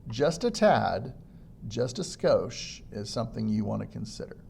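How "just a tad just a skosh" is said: slowed down. Shown as a demonstration. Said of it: "intentionally slowing down".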